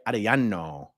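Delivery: Arellano with tapped r and Spanish "y" for ll; Spanish accent. Shown as a demonstration. Streamer trying out a viewer's Latin name, Spanish accent. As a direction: say Arellano as a Spanish speaker would. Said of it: "a Latin name just with an American accent".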